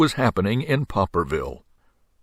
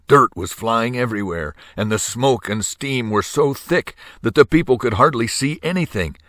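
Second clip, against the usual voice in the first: usual speaking voice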